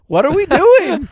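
high, loud baffled outburst; mock exasperation. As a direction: cry out in comic disbelief. Mock outrage at changed dialogue.

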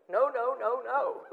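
loud, high, repeated NO, NO, NO; emphatic refusal. Retold or imitated. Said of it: "severe negative".

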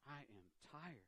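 barely voiced, drained admission; very weary. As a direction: let it sink away, very soft and tired.

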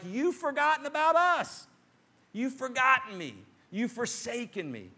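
high, pleading complaint; a hurt, whining plea; whiny complaint. Retold or imitated. Voicing people complaining at table.

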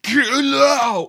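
high, drawn-out hammed-up shout; playful high-pitched yelling; mock yell. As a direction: holler it like the bratty daughter. Joins in daughter's yelling.

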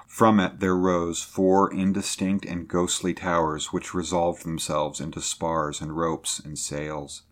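usual speaking voice

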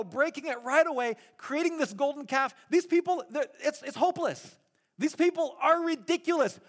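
God at the end of his patience, venting; God fed up with his ungrateful people; exasperated God. Retold or imitated. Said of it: "What God was essentially saying here is".

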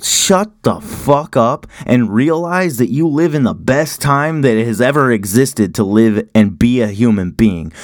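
ordinary talk, nothing marked

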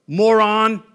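loud contemptuous jab; a loud mock sneer; scornful insult. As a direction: spit out the word Moron at the neighbor. Mocking neighbor to family.

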